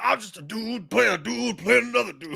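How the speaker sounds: mock the other speaker's impression; Tropic Thunder impression. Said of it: "You sound like".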